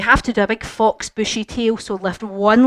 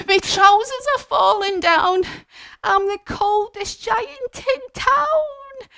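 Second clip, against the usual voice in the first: much higher, slow mock wail; panicked cold giant; 11 semitones higher, slower than usual.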